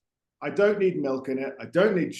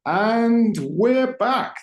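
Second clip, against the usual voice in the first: drawn-out show opener; 8 semitones higher, 5 dB louder, much slower than usual. Acted. Podcast host opening show.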